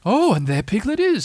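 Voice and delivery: Owl. Acted. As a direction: sound pleased and self-important.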